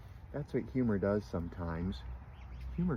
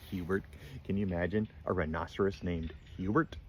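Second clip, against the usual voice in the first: plain reading voice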